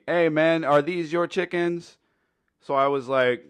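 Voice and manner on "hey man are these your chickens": high, raised voice calling from a truck; a stranger in a pickup yelling a question at the teller; retold yell. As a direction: call out across the alley, half yelling. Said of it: "and yells at me".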